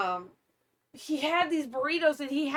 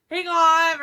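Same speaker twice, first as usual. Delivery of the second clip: mock warning, raised and louder; called-out warning; 6 semitones higher, 7 dB louder than usual. Wife listing what her husband could have said, called-out warning. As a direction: call it out as a pretend warning. Acting another warning he didn't give.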